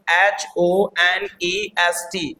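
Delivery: letters spelled one by one, slowly. Shown as a demonstration. Teacher spelling a word slowly for students. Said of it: "अब मैंने बोला honest".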